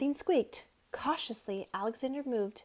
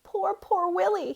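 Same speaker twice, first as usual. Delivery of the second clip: near tears; 8 semitones higher, 5 dB louder, slower than usual. A narrator's reading. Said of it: "almost in tears".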